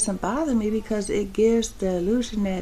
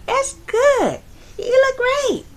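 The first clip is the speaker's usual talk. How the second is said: put-on higher, louder friendly young man; young clerk; 13 semitones higher, 6 dB louder than usual. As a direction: imitate the kid's upbeat compliment. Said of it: "He goes".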